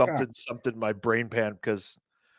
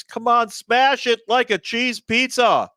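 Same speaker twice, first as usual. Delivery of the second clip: excited call out; 10 semitones higher, 5 dB louder, much slower than usual. Acted. Pleading for likes on stream.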